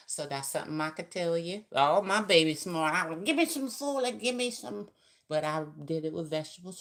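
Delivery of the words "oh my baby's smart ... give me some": mock eager mother. Mother voiced, demanding folic acid.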